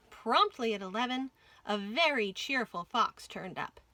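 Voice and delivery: plain reading voice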